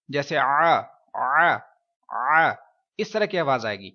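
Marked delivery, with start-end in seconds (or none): 0.1-2.5 آ pushed from the throat, louder; ain throat sound. Shown as a demonstration. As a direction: demonstrate the عین sound as a throat آ. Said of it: "اس حرف کو کچھ یوں پڑھیں گے جیسے عین". (حلق سے عین کی آواز)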